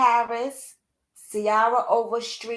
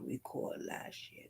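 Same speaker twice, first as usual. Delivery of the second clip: whisper; 19 dB quieter than usual. Real, not acted. Aside while checking details.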